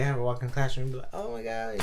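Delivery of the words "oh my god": much higher, softer girly mock gasp; mock girlfriend gasp. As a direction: play the thrilled girlfriend lightly. Imagined girlfriend's delighted reaction.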